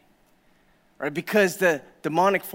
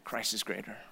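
hushed declaration; 7 semitones lower, 12 dB quieter than usual. Real, not acted. Repeated sermon line, first take hushed.

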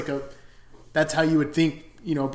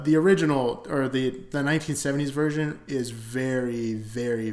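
ordinary delivery

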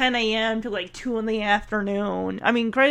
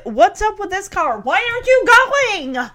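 imitated impatient yell; 8 semitones higher, 5 dB louder than usual. Acting out an impatient passing driver.

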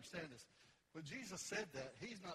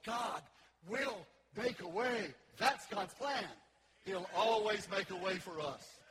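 loud, emphatic declaration; full-force preaching; 4 semitones higher, 11 dB louder, slower than usual. Real, not acted. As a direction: punch out the promise to the congregation.

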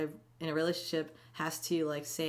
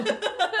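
big laugh; 6 dB louder than usual. Real, not acted. Laughing at mohawk story.

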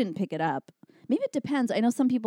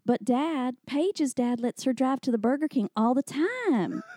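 put-on whiny teenage Texas accent, pitched up; teen Texas twang; 7 semitones higher, slower than usual. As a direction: beg Dad in a thick Texas drawl. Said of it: "this is what I sounded like exactly".

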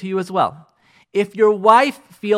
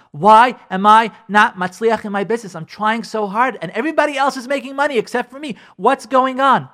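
higher, louder exasperated whine; mock frustrated complaint; 6 semitones higher, 5 dB louder than usual. Businessman asking himself why he fails, exasperated. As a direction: vent like a man whose business is failing.

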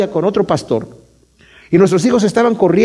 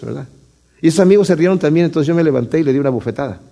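ordinary talk

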